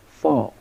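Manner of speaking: "fo" as the start of fork; sound as in fork. Shown as a demonstration. Teacher sounding out euphoric's middle syllable, "fo" as in fork. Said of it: "like in fork".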